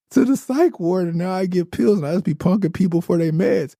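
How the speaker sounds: high wild voice. Retold or imitated. Quoting Booth from jail.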